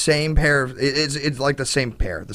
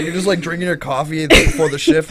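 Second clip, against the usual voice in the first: ordinary delivery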